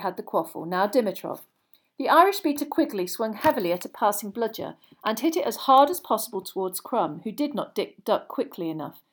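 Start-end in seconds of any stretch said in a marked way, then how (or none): none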